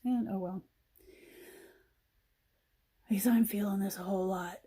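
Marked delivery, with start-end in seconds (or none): 3.1-4.6 breathy, choked-up whisper, a bit fast; emotional whisper. Real, not acted. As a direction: whisper it as feelings well up. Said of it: "and I'm getting emotional".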